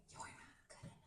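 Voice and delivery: soft, insidious inner whisper. Acted. Ego planting fear in the listener, whispered. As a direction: whisper it like creeping self-doubt. Voicing the ego's inner doubt.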